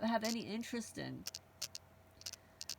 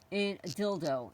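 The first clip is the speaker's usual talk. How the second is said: normal talking voice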